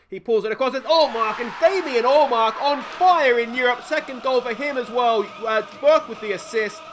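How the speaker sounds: loud, high excited commentary; goal celebration. Real, not acted. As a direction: call the winner with delight. His team scores again.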